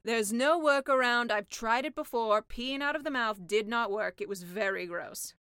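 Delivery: deadpan the gross detail; sea witch voice. Acted.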